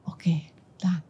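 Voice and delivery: soft unvoiced whisper; whispered voice of God. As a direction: breathe it gently like a calm command. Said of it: "Tuhan bilang". (bisikan lembut suara Tuhan)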